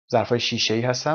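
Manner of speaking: normal talking voice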